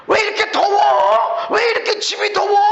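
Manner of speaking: high, loud whiny complaining shout; mock complaining. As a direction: whine about the heat. Mimicking a complainer about heat.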